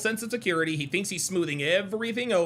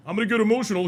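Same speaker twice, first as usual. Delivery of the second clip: mocking Abba; 4 dB louder, much faster than usual. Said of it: "That's what Abba said".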